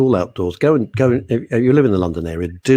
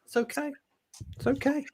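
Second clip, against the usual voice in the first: higher, much softer, self-pitying; mock wounded; 11 semitones higher, 12 dB quieter than usual. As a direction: sigh it out like a martyr. Mock hurt at being disliked.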